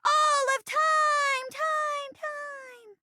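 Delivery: high, drawn-out words fading away; mock echo. Stage line with trailing echo.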